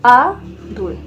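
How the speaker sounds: आदुल slowly as a long आ, then दुल; syllable by syllable. Shown as a demonstration. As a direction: leave a gap between आ and दुल. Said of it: "लिखना है".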